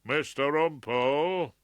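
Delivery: slow, loud booming call from the bench; trumpeting judge. A narrator's reading. Judge rebuking the barrister from the bench, trumpeting. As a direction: trumpet his name. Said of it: "the voice of robust common sense trumpeted".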